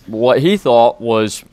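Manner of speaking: plain reading voice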